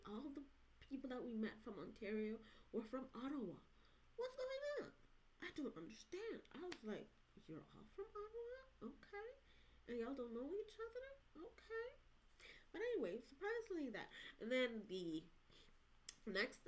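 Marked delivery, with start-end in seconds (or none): 4.2-6.4 high-pitched bewilderment; baffled. Retold or imitated. Bewildered by all being from Ottawa. 7.5-11.9 high, softer puzzled questioning; puzzled disbelief. Retold or imitated. Acts out her puzzled reaction.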